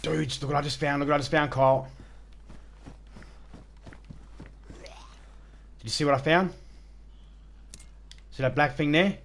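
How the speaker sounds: excitedly showing off. Real, not acted. Excitedly shows off find.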